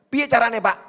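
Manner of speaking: high, loud incredulous ask; loud mock question. Congregation member asking the pastor, loud and disbelieving. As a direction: call it out like a baffled listener.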